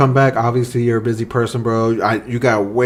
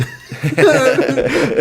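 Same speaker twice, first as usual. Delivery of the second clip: embarrassed laugh. Real, not acted. Laughs at own name slip.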